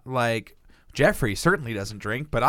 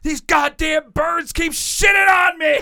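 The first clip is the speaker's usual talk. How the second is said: higher, louder hammed-up yell; mock angry shout; 13 semitones higher, 9 dB louder than usual. Imitating the angry guy shouting at the birds. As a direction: bellow at the birds overhead. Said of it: "this guy is just like".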